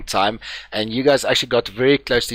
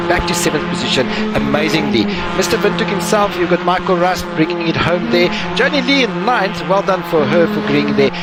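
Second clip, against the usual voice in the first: race results announcer; 6 semitones higher than usual. Acted. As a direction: announce the finishing order.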